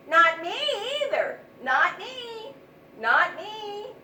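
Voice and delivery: high, loud chorus of refusals; each mouse blurts not me; squeaky mice refusing. Acted. Scared mice dodging the job, loud and high.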